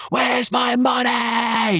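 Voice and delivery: high, slow, drawn-out mock scream of rage; imitated screaming. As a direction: scream it out like the boss wanting his cash. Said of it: "I remember Ronnie screaming".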